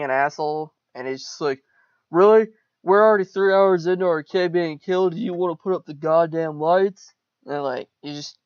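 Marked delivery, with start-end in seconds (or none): 2.1-7.1 high, loud acted outburst at his wife; mock furious dad yelling at his wife; angry yell. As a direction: snap at her in disbelief, then rant. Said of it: "just yelling at her for no reason".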